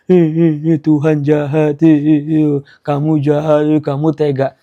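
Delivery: tiruan tangis merengek, setengah main-main; pura-pura merengek, setengah bercanda; mock crying. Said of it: "Kalau dulu nangisnya". (tiruan tangis merengek kepada Tuhan)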